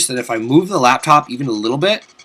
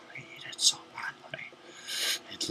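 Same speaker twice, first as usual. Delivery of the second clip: longing whisper; 21 dB quieter than usual. Real, not acted. Craving the cake.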